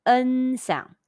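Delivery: "N sound" breathed unvoiced, half-open mouth, spread lips; "N sound" as a toneless whisper, mouth half open. Shown as a demonstration. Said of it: "half open mouth, spread lips".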